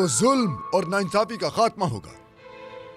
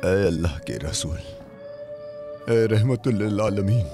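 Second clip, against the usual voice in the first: devoted and tender; 8 semitones lower than usual. Acted. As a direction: speak lovingly to a revered master. Speaks devotedly to the Prophet. (अपने आका से नर्म, गहरी और अकीदत भरी आवाज़ में वादा)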